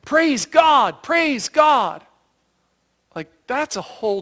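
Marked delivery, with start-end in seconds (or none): 0.0-2.0 louder, higher cry of praise; joyful shout. Retold or imitated. Healed Samaritan returning to Jesus, shouting praise. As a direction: shout your thanks to God. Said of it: "But he's shouting".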